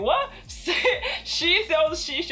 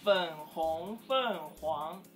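slow, careful syllables; slow teaching model; 6 semitones lower, 5 dB quieter, slower than usual. Shown as a demonstration. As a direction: draw it out syllable by syllable. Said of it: "teaching the phrase".

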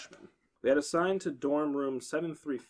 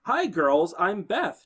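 bubbly chirp; 5 semitones higher, 6 dB louder, much slower than usual. A narrator's reading. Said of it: "chirped a bubbly blonde girl".

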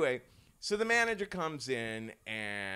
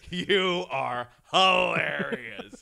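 loud, drawn-out laughing shout; hammed-up slow cackling delivery; hysterical amusement; 4 semitones higher, 10 dB louder, much slower than usual. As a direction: act helplessly tickled, stretching every word. Waiter acting out hysterical mockery.

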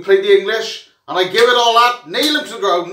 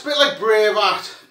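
ordinary talk, nothing marked

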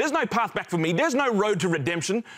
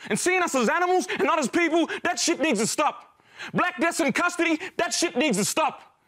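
high-pitched, impassioned anger; righteous fury, pitched well up; angry outburst; 6 semitones higher than usual. Acted. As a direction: drive each grievance home with raised pitch.

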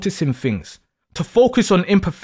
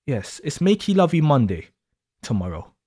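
soft suggestive repeat; 5 semitones lower, 5 dB quieter than usual. Acted. Teasing partner, lower softer repeat.

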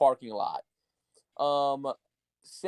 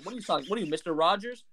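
ordinary talk, nothing marked

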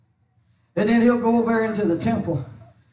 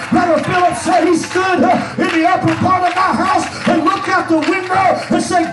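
driving, shouted pulpit delivery, rising in pitch; full-force preaching; 6 semitones higher, 4 dB louder than usual. Acted. Preacher testifying to the congregation about Brother Philip, full-force preaching. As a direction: preach it hard with gasped "uh" breaks.

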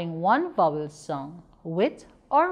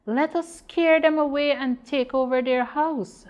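eager donkey's plan, pitched higher and louder; plotting donkey; 7 semitones higher, 4 dB louder than usual. Acted. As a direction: propose the scheme like a mischievous old donkey. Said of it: "suggested the donkey".

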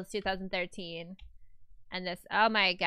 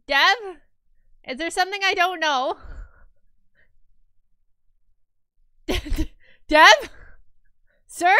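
loud call; 8 semitones higher, 11 dB louder than usual. Real, not acted. Calling out a viewer in alarm.

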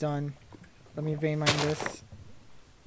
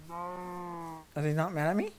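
ordinary talk

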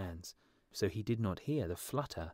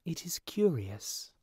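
swallow musing; 8 semitones higher, slower than usual. A narrator's reading.

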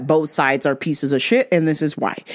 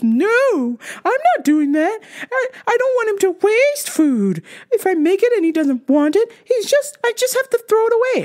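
much higher, whiny, near-crying mock voice; imitating mom. Daughter mimics mom refusing to cook, high whiny mock voice. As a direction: whine it like a fussing mother. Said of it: "She goes".

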